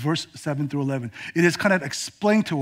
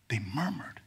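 whispered, much softer; 6 semitones lower, 13 dB quieter than usual. Acted. Preacher whispering what Israel did. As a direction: breathe the word out. Unvoiced, sermon emphasis.

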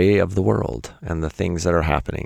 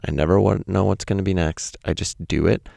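ordinary talk

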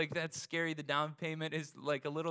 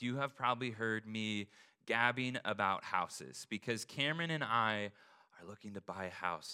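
ordinary delivery

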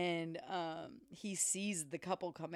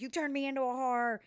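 higher, louder hurt outburst; upset fiancee; 7 semitones higher, 5 dB louder than usual. Retold or imitated. Betsy accusing Nick, hurt. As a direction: throw the accusation at him.

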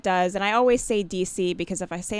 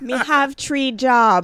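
loud, slow, hammed-up Jamaican accent; 7 semitones higher, 8 dB louder, much slower than usual. Shown as a demonstration. Embarrassed comic pressed into attempting a dialect, loud mock Jamaican accent. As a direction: belt out a badly faked Jamaican accent. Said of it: "do a Jamaican accent".

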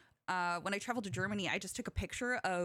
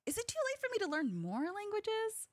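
worried self-quote; 10 semitones higher than usual. Retold or imitated. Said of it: "but I'm like".

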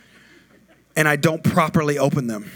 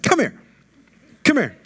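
sharp high call across the field; 8 semitones higher than usual. Retold or imitated. Father calls son from sidelines.

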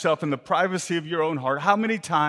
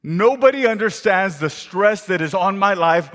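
loud, higher pleading complaint; frustrated inner monologue; 5 semitones higher, 5 dB louder than usual. Acted. Martha complaining to herself, loud frustrated rant. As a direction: pour out her stress like nobody listens. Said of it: "internal monologue in her own self".

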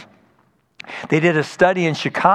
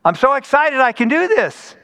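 put-on much higher, bright excited teen voice; excited girl; 10 semitones higher than usual. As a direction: imitate a thrilled teenage girl. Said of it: "A sixteen-year-old girl said to her pastor".

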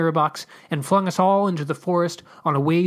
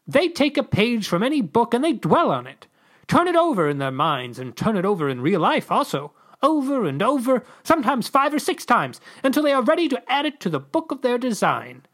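lecturing ambassador; 9 semitones higher than usual. Acted.

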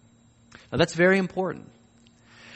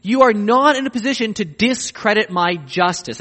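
higher and louder, firm; God telling Job he cannot judge, firm and raised; stern divine rebuke; 6 semitones higher, 5 dB louder than usual. Acted. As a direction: lay down the verdict to Job. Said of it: "In other words".

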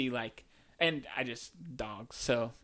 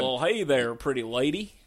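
Texas accent; 3 semitones lower, 4 dB louder than usual. Shown as a demonstration. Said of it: "Can you do a Texas accent?".

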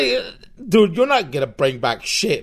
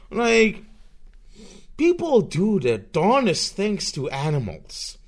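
ordinary talk

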